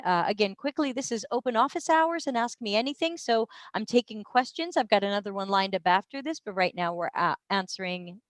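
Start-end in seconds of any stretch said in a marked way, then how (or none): none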